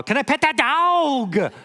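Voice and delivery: put-on very high, drawn-out Southern little-girl plea; long Southern drawl. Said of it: "with a beautiful long drawl".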